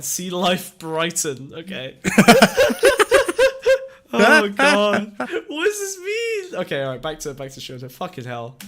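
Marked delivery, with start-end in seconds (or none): 2.0-3.9 high shrieking laugh; helpless shrieking laughter at what he sees; burst of laughter. Real, not acted. 4.1-6.6 slow words squeezed out through laughter; laughing so hard the voice goes high and breaks; laughing fit. Real, not acted.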